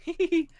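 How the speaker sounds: high, gleeful giggle "hee hee hee"; a smug little titter at their shock; giggling to himself. Acted. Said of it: "laughing to himself".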